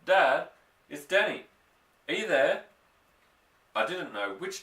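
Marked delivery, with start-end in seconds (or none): 0.1-2.6 high, loud anxious calling. A narrator's reading. Boy calling for his father in the dark wood, high and loud. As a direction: call out into the darkness, hoping.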